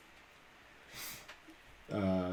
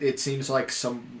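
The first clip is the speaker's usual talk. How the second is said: ordinary talk, nothing marked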